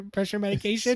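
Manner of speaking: ordinary talk, nothing marked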